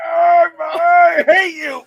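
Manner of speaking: high, loud anguished yell; pained shout. Real, not acted. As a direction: yell it out in burning pain. Burning from a super-hot dab.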